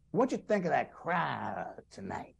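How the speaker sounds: lightly voiced old comedian, slow and drawn out; a slow, drawling hint of an impression; Bob Hope. Bob Hope asking about the crowd after the show, lightly imitated. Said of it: "he says to me".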